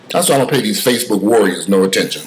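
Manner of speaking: ordinary talk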